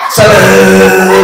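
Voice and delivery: drawn-out, sung puppet echo; puppet Afa's voice. Acted. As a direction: stretch "Salam" as the literal-minded puppet. Puppet takes prompt literally.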